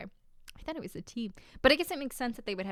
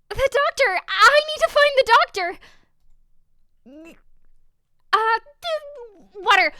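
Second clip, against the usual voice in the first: frantic, much higher and louder shouting for help; panicked cry; 12 semitones higher, 10 dB louder than usual. Acted. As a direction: scream for the doctor as the master collapses. Maid finds master collapsed.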